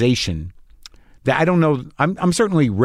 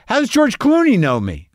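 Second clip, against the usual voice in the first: pitched well higher in stunned wonder; amazed disbelief; 9 semitones higher than usual. Retold or imitated. A fan puzzling over being recognized, high and amazed. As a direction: marvel that a star knows you.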